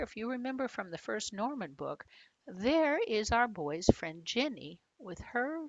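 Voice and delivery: ordinary delivery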